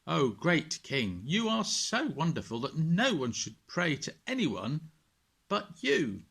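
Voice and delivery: butter up the king, voice climbing; flattering servants. Acted. Scheming servants fawning over King Darius, oily flattery.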